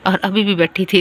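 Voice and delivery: usual speaking voice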